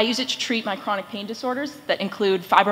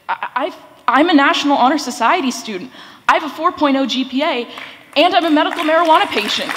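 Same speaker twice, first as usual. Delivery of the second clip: stage fright; 3 semitones higher, 4 dB louder, faster than usual. Real, not acted. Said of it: "Sorry, I'm a little nervous".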